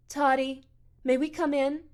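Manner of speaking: high, coaxing voice with a rising question; doting mother. Acted. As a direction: coo gently to your poor darling son. Mother coaxing her son at door.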